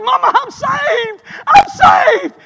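high, loud, slow joyful shout to mama; ecstatic shout. Retold or imitated. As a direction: cry it out to mama in joy. Boy shouts to his mama.

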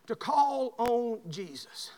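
slow deliberate preaching; slow, drawn out. Shown as a demonstration. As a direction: stretch each word slowly. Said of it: "I want to show you this".

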